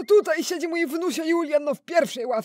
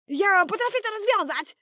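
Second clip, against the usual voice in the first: put-on a grandpa putting on his grandson's higher kid voice; imitating Julian; 5 semitones higher than usual. Grandpa fakes his grandson's voice for the teacher, higher. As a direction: pass himself off as the boy, pitched up. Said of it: "Muszę poudawać trochę Juliana".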